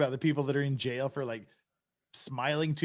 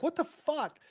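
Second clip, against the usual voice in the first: imitated astonishment; 12 semitones higher, slower than usual. Friends learning both are in Korea, imitated astonishment. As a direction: jump up high in mock amazement.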